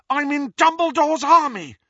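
high, loud protest; heated, high-pitched insistence; defiant shout. A narrator's reading. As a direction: yell it back at your mother. Daughter argues back at shouting mother.

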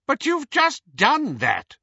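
high, loud, imperious Bellatrix; commanding, arrogant. Acted. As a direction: snap it with haughty command. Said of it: "in Bellatrix's commanding, arrogant voice".

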